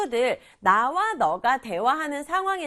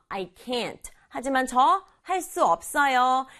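usual speaking voice

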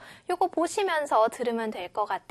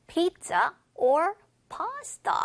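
slow choice question, settling down at the end of "pasta"; rise, then slight fall; 4 semitones higher, much slower than usual. Shown as a demonstration. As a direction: lift "Pizza", then ease down on "pasta". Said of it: "살짝 내려가서 끝날 겁니다".

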